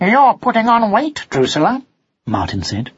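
high, innocent questioning voice; high-pitched concerned remark; young cat. A narrator's reading. Son cat talks to his pet.

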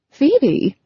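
a touch of confusion, voice lifted high; confused. A narrator's reading. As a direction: repeat the name in slight bewilderment. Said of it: "clearly confused by R J's sudden change of heart".

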